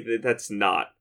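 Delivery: ordinary talk, nothing marked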